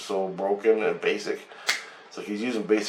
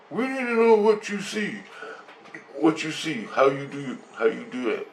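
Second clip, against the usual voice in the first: much higher, otherworldly alien voice; 5 semitones higher than usual. Retold or imitated. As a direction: speak high like a strange visitor. Voicing the alien in story.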